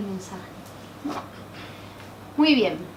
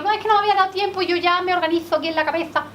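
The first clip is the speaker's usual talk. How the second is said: high, very fast mock excuse; 5 semitones higher, much faster than usual. Teacher to class, mimicking a student who won't write notes, high and rushed. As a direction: rattle it off like a student dodging the work.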